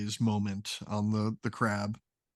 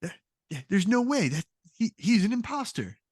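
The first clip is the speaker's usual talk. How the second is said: very high squeaky accusation; squeaky henchman calling the other guy an impostor; 9 semitones higher than usual. Acted. Continuing the squeaky figure.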